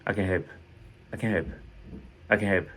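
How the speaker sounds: no time spent on "can"; quick, light CAN. Shown as a demonstration. English teacher showing French speakers positive "can", quick and light. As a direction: say "can" short and light, like "ken". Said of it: "donc on ne perd pas le temps dessus".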